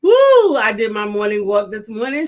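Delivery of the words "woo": excited whoop. Real, not acted. Cheering on air.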